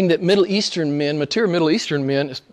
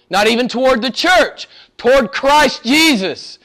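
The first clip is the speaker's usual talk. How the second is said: higher-pitched, emphatic pulpit exhortation; impassioned preaching; 7 semitones higher than usual. Real, not acted. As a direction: drive each phrase home to the congregation.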